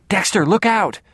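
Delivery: high, alarmed cry; urgent warning. A narrator's reading. Sister warning her brother of the gun, urgent cry. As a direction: call out sharply, pitch jumping up.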